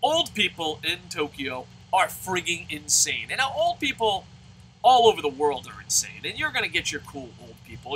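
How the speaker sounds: ordinary delivery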